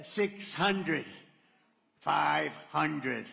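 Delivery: lightly voiced cockpit computer, evenly counting down the altitude. To the crowd, imitating the plane's altitude computer. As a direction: call the numbers like a machine. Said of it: "It's an incredible voice, a computer voice".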